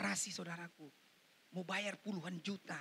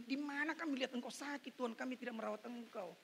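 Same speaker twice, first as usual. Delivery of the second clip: ordinary talk, nothing marked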